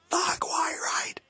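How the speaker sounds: very high, slow, strangled whispered wail; studio-take cry. Retold or imitated. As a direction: squeal it like a man losing it. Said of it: "Studio version, he does".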